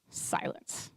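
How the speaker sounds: breathy whispered "Silence."; a deadpan whispered beat. Retold or imitated. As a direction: drop to a bare whisper for effect. No reply to texts.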